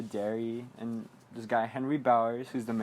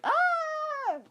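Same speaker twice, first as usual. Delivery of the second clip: very high, drawn-out mock scream. Georgie sees the scary mouth, mock high scream. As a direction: imitate a child's high scream.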